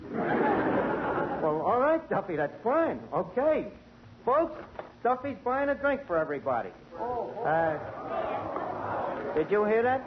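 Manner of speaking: ordinary delivery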